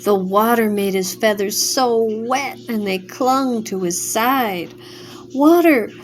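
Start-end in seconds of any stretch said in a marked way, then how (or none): 5.4-5.9 high, loud pleading cry; begging for mercy, high and loud; desperate cry. A narrator's reading. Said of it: "cried the half-chick".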